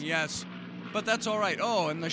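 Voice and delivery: shouting over noise. Real, not acted. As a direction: raise your voice over the rotor roar. Helicopters passing overhead.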